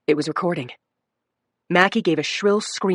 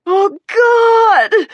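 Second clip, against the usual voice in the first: very high, loud scream of pain; 8 dB louder than usual. A narrator's reading. Injured woman screaming in the ER. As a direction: cry out in agony. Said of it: "she screamed out".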